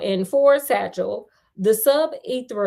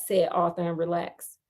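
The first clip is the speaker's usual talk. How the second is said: ordinary delivery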